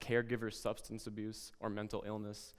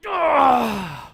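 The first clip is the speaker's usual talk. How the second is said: loud high frustrated scream; child scream; 14 semitones higher, 19 dB louder than usual. Retold or imitated. Boy throwing a tantrum in class, loud high scream. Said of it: "and he screamed".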